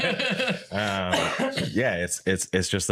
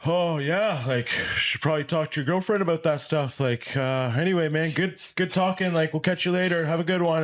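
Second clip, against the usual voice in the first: much higher, breezy brush-off voice; dismissive buddy; 11 semitones higher than usual. Retold or imitated. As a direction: imitate a friend hurrying off the phone. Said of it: "he was like".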